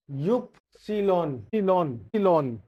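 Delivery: युप्सिलॉन said slowly, "oop-si-lon"; German Y. Shown as a demonstration. Teacher breaks युप्सिलॉन down for students, slowly. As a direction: drill सिलॉन with a falling end. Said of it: "इसको बोलते हैं युप्सिलॉन".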